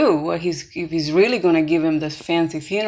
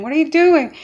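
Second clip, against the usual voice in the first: high, louder astonished question; shocked; 8 semitones higher, 5 dB louder than usual. Retold or imitated. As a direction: act out a shocked onlooker's disbelief. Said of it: "everybody looks at him shocked".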